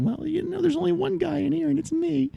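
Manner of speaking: very high, squeaky acted retort; high-pitched comeback. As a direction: pitch it way up for a mock-innocent answer. Retold cheeky comeback to mom.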